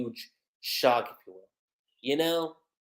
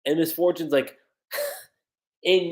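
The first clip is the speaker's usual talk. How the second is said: normal talking voice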